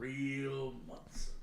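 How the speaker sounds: drawn-out echo. Pretend. Echoing the phrase slowly.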